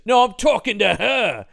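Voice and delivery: mock indignant shout. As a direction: shout it like a cheesy film actor. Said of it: "He goes".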